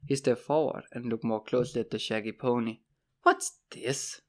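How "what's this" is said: put-on incredulous question; mock disbelief. A narrator's reading. Horace spotting the shaggy pony, in mock disbelief. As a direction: feign disbelief, mockingly. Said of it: "he asked in mock disbelief".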